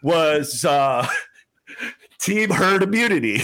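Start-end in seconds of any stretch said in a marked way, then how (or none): 1.1-3.4 laughing. Real, not acted. Reveals joke team name, laughing.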